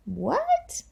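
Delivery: high, soft astonished "what?"; playful storyteller's gasp of surprise; mock surprise. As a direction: pretend to be amazed. Reading mock surprise in book.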